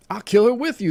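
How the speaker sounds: high, loud indignant cry. A narrator's reading. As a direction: join the threat at the top of your voice. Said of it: "the other one cried".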